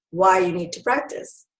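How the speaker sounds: ordinary talk, nothing marked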